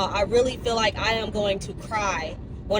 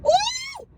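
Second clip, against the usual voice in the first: high-pitched "woo!"; excited whoop. Real, not acted. Cheering to her viewers, high excited whoop. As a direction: let out a high, celebratory woo.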